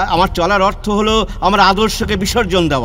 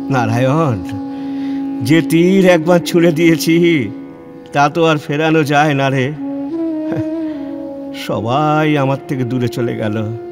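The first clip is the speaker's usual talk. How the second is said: low, heavy-hearted grieving father; sorrowful lament; 4 semitones lower than usual. Acted. Father alone after disowning his son, sorrowful lament. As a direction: let the voice sink in resigned sorrow.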